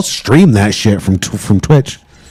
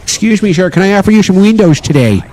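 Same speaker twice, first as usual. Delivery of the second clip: high-pitched salesman pitch; door-to-door salesman; 9 semitones higher than usual. Acted. Salesman offering windows at the door, mock salesman voice. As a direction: put on a high, polite salesman voice. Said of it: "that poor door-to-door salesman".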